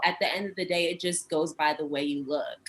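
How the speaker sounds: low, soft, matter-of-fact retelling; flat-out blunt. Retold or imitated. Promoter telling her why she has to pay, quietly blunt. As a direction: quote him bluntly, dropping low and quiet. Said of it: "he said flat out".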